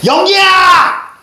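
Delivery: high, loud, drawn-out call of the name; long shout. Pretend. Teacher shouts the name for fun, long and loud. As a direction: stretch the yell out as far as it goes.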